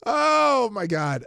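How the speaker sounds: loud, high laughing oh my God; real laughing outburst. Real, not acted. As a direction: burst out laughing, can't help it. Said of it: "I don't know why I laughed at that".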